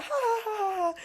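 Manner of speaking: very high ha ha ha; high mock laugh. Coworker laughing back, a very high ha-ha. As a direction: cackle way up high. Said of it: "And she was like".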